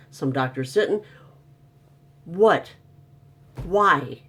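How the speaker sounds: worked-up complaint about masks; exasperated rant. Real, not acted. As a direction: vent it, pitch climbing. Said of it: "ranting about masks".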